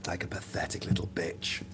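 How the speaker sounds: hissed under the breath; scornful whisper. Real, not acted. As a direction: drop to a contemptuous whisper. Scornful aside after the mockery.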